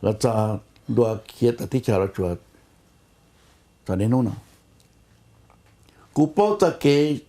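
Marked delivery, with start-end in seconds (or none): none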